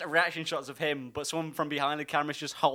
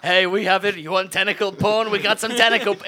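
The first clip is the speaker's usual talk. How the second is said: shady street dealer; 3 semitones higher, 7 dB louder than usual. Retold or imitated.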